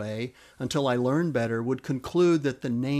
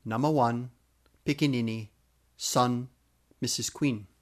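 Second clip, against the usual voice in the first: Namawan, pikinini, son, Missus Queen with pauses between; word by word. Shown as a demonstration.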